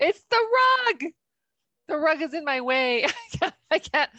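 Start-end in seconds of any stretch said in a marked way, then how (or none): 0.0-1.1 surprised. Real, not acted. Chair stuck on new rug.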